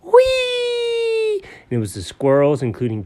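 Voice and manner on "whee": very high delighted squeal; gleeful whee. Acted. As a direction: squeal down the slide. Sliding down the slide.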